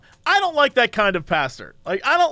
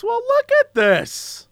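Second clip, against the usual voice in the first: high, slow amazed repeat; drawn-out wonder; 12 semitones higher, slower than usual. Real, not acted. Slow amazed drawl to friend over a map. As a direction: marvel at it slowly, pitched up.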